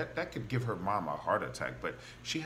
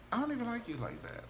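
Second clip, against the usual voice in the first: quick, higher voice for a snubbing character; sassy brush-off; 11 semitones higher, 4 dB quieter, faster than usual. Retold or imitated. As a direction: brush them off fast in a higher voice. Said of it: "they be like".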